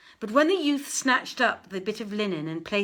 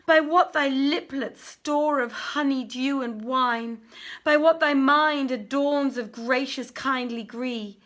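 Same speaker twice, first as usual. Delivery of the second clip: lifted, declaimed verse, a bit higher and louder; recited love couplets; 6 semitones higher, 5 dB louder than usual. Acted. Youth reciting couplets over his goods, lifted lament. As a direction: recite the verses like a grieving lover.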